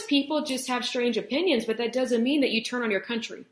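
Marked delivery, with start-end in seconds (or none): none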